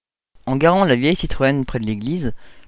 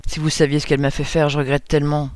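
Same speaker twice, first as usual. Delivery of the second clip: usual speaking voice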